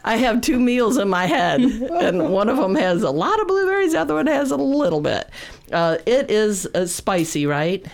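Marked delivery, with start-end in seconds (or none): 0.0-5.3 laughing at herself; giggling through the words. Real, not acted. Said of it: "I'm laughing at myself".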